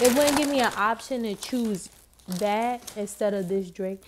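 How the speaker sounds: normal talking voice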